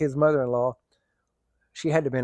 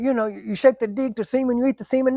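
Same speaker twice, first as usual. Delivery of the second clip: mock voice; 8 semitones higher than usual. Mocking misheard cooking advice.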